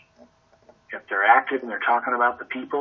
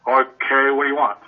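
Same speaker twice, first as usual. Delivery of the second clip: loud, blunt and impatient; curt dominant client; 2 semitones higher, 7 dB louder than usual. Retold or imitated. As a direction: bark it like a busy boss. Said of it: "I know I have a D".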